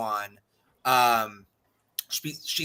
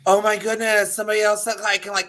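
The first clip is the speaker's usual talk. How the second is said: excited aunt; 5 semitones higher than usual. Retold or imitated. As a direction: imitate an aunt thrilled to have someone to talk to. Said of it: "she was so excited".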